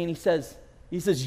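ordinary delivery

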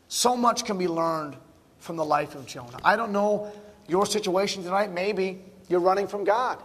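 normal talking voice

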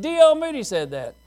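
high, loud accusing call. Retold or imitated. Student calling out the preacher before the class, high and loud. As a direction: call him out to the whole room. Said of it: "He said".

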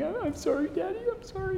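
put-on high, soft little-child whimper; imitating a whimpering toddler. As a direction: mimic a small boy saying sorry through tears. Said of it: "he started crying and kind of whimpering".

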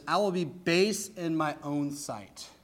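"I will be base in my own sight" slow, soft, each word weighted; slow emphatic. Real, not acted. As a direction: linger on "base", slowly and quietly. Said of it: "listen to this".

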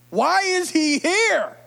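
mock-anxious plea; frightened townsfolk. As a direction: beg him to leave slowly. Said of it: "what's he gonna do to me".

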